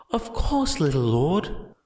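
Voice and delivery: a huge brute fawning on his little master; happy giant. A narrator's reading. Gigantomachia's devoted reply.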